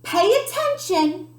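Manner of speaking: a touch of sharp impatience; lightly voiced cross music teacher; Maestro. A narrator's reading. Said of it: "snaps Maestro".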